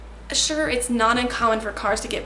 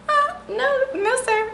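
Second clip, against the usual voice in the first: much higher, mock-rude "no, no, sir"; hammed-up snooty "no, sir", pitched way up; rude refusal; slower than usual. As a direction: brush him off with exaggerated rudeness. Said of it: "Like, being rude".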